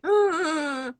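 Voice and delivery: imitated whimpering cry, pitched high; re-enacting a little sob; mock crying. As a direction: act out a tearful whimper. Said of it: "I did cry. When you left, I was like".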